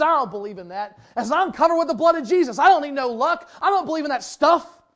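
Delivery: loud, high, self-righteous retort; indignant boast. Retold or imitated. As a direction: throw it back like a proud rebuke. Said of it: "He got real indignant".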